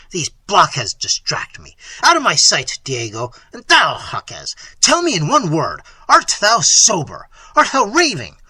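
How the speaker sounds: exasperated master barking questions; furious prince. Acted. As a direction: browbeat the servant into speaking. Voices Manfred, furious prince.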